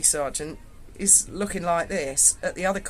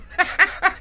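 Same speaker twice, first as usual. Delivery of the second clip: bright laugh; 11 semitones higher, 5 dB louder than usual. Real, not acted. Laughing at own story.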